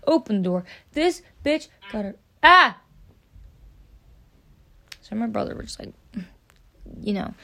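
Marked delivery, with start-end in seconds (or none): 2.4-2.8 sudden sharp startled cry, higher and louder; shocked yelp. Retold or imitated. Opening the bathroom door on the girl, a startled yelp.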